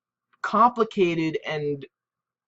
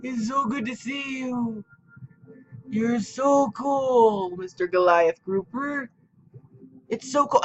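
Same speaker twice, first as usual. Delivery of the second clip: cooing at a fish; 9 semitones higher, slower than usual. Real, not acted. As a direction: coo like greeting a pet. Greeting a fish in game.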